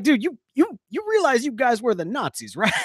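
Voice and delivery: high mock disbelief; incredulous. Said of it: "I'm like".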